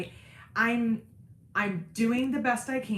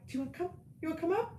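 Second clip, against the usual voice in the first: higher, softer coaxing call, like inviting a shy little one; gentle coaxing; 8 semitones higher, 6 dB quieter, much faster than usual. Real, not acted. Mother coaxing her shy girl to come up, gently and higher. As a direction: coax her up sweetly.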